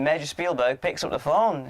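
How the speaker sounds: usual speaking voice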